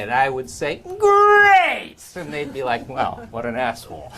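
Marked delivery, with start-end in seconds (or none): 1.0-2.0 high, drawn-out sarcastic cheer. Retold or imitated. Storyteller answering a morning how-are-you, sarcastic. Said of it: "being only sarcastic to everyone I met". 2.9-4.1 muttered aside. Retold or imitated. Voicing people's muttered reaction to him.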